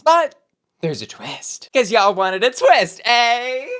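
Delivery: big high "But", hushed pause, then loud teasing "twist"; dramatic reveal. Acted. To viewers, teasing the format twist, dramatic build. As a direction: play up the twist like a game show host.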